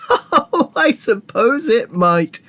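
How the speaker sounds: high, drawn-out merry concession; high, breezy unconcern; cheerful. A narrator's reading. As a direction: concede it slowly, brightly amused. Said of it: "cheerful as ever".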